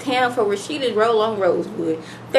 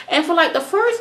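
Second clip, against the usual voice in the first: ordinary talk, nothing marked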